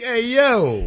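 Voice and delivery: lightly voiced street slang; mock street greeting. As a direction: toss off a casual "Hey, yo". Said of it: "be like".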